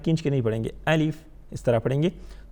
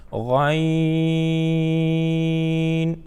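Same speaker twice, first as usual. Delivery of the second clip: long drawn out; much slower than usual. Shown as a demonstration. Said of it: "غین کو کتنا کھینچ کے پڑھیں گے؟".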